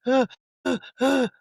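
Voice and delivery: put-on high stuttering sob noises like a little kid; childlike sobbing sounds. Son at his mom's door, unable to speak, imitating childish sobs. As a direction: mimic a four-year-old choking on tears. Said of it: "like a four-year-old".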